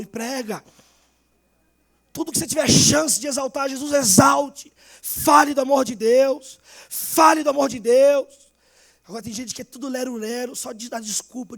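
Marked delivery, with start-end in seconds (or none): 2.1-8.3 louder, slow pulpit exhortation; preaching at full force. Acted. Pastor telling the church to exalt Jesus, rising preaching.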